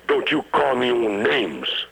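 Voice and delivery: mildly combative retort to his friend; pugnacious. Retold or imitated. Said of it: "glowered Migas pugnaciously".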